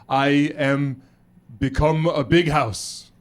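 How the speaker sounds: slow, halting learner's English; hesitant Austrian kid, building to a proud finish; Austrian pupil. Acted. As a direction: grope for each English word like a schoolkid. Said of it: "one kid was like".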